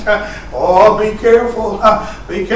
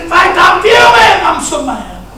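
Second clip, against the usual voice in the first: higher, louder mock fuming; retold mad outburst; 12 semitones higher, 8 dB louder than usual. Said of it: "I said".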